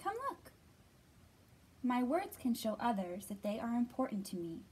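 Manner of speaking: expressive storybook reading voice; reading to children. Acted. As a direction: read it gently to little kids. Reads picture book to children.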